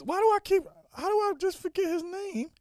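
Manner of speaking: high-pitched incredulous squeal; squeaky, baffled frustration; exasperated self-reproach. Real, not acted. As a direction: complain at your own blanking memory. Frustrated at forgetting a name.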